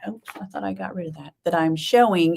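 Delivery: normal talking voice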